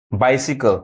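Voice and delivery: बाइसिकल as 'BAI-si-kal'; taught pronunciation. Shown as a demonstration. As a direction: say बाइसिकल with 'si' in the middle. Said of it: "ई sound पढ़ाता है". (बाइसिकल में 'सि' बोलें, 'साइ' नहीं)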